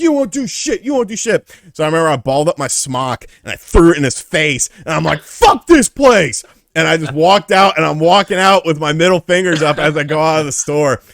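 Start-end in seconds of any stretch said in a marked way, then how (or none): 0.0-1.5 high, loud repeated jeer; taunting dare. Retold or imitated. Pharmacist goading his coworker during back-and-forth quarrel, high loud taunt. 5.2-6.4 loud, high, drawn-out yell; defiant shout. Retold or imitated. Worker quitting in a fury as he walks out, slow defiant shout. Quits, throwing smock in argument.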